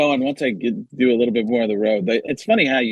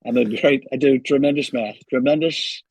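Donald Trump. Acted. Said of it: "back to Trump".

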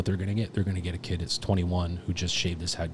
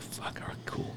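soft whispered aside; hushed mutter of giving in; whispered resignation; 13 dB quieter than usual. Retold or imitated. Inner reaction to gear briefing.

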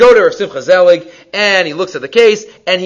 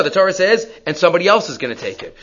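ordinary talk

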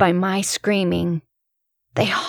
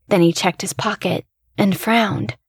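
usual speaking voice

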